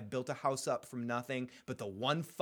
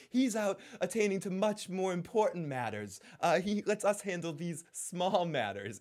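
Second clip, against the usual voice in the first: lightly voiced Varys; 5 semitones higher than usual. Varys' description of whoring.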